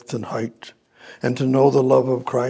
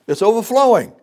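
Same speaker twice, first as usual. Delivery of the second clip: high, loud exultant cry; ecstatic; 11 semitones higher, 5 dB louder, faster than usual. Real, not acted. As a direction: burst out with joy. Preacher exulting over verse.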